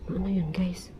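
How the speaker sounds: voiceless, wary whisper; whispering a nervous question. Real, not acted. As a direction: ask it under your breath. Hears something, hushed.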